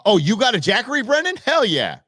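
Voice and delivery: loud, high burst of excitement; excited approval. Real, not acted. Host hears a listener bought a Jackery, excited approval. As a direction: cheer on a buddy's new purchase.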